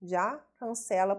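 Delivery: ordinary talk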